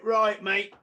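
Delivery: loud, high retold challenge; confrontational call. Manager back at the door with two heavies, confrontational. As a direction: announce yourself with backup behind you.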